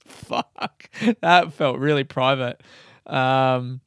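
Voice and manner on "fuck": normal talking voice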